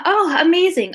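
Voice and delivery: ordinary talk, nothing marked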